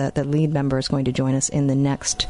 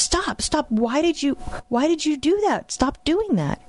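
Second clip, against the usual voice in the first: imitating angry child; 12 semitones higher than usual. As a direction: act out her upset son's high-voiced complaint. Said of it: "he gets really angry".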